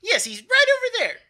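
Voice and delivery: very high, loud character voice; exasperated townsperson. Acted. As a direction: point them off loudly in a high voice. Game master answers as bystander.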